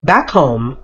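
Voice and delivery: ordinary delivery, nothing marked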